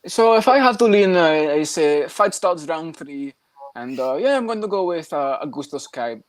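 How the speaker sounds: slow, deliberate pundit imitation; Vlad. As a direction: imitate a hesitant fight predictor. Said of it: "talking exactly how he does in his videos".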